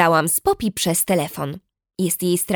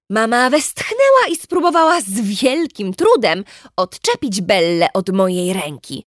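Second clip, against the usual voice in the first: ordinary delivery, nothing marked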